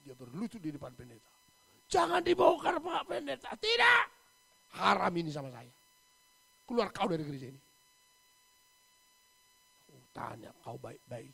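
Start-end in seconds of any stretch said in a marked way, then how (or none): 1.9-3.6 loud pleading cry; desperate plea. Retold or imitated. Kneeling widow crying out to the pastor. 3.6-7.6 loud flat refusal; angry refusal. Retold or imitated. Pastor loudly refusing the kneeling widow.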